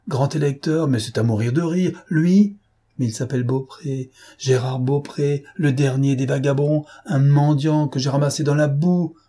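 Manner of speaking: moquerie rageuse, voix plus haute; ricanement rageur. Acted. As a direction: raille le faux duc avec fureur. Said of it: "Il ricanait rageusement". (ricanement furieux et plus aigu)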